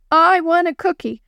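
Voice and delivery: high, insistent chant of demand; mild shout of demanding kids; children shouting. A narrator's reading. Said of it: "they shouted".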